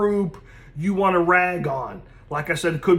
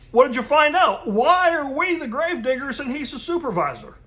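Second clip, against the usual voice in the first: much higher, eager questioning worker; curious grave digger; 7 semitones higher than usual. Acted. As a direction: pitch it up, keen to hear the answer. Joke, worker asks his partner.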